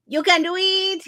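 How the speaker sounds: high, loud cheer. Acted. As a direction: pump them up with a loud cheer. Cheering on a viewer.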